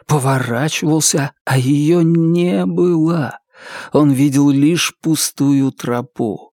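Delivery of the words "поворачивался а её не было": nightmare moaning. A narrator's reading. Slowed, drawn out repetition.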